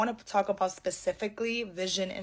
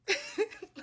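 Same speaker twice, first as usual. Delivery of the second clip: exasperated laugh; 10 semitones higher, 6 dB quieter than usual. Real, not acted.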